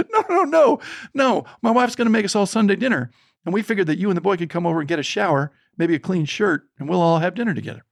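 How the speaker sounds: high, fast, hearty no no no; excited mock-hearty host; eager reassurance. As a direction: rush to calm them with a warm invitation. Mechanic cheerfully invites them to dinner.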